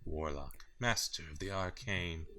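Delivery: slow, hushed mock-epic announcement; dramatic title reveal. Co-host grandly announces the game's title, slow and mock-dramatic. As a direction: intone it softly like a fantasy trailer.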